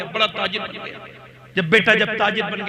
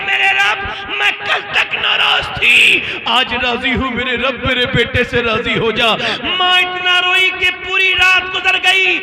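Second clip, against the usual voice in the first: tearful crying prayer; 8 semitones higher, 5 dB louder than usual. Acted. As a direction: cry out to God with the mother's grief. Mother's tearful prayer, preacher rising. (روتی ہوئی بلند آواز میں دعا)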